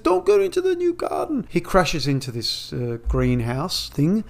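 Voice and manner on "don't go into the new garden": very high mock-warning voice; high squeaky hammed-up caution. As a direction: shriek it like a cartoon onlooker. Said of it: "He says".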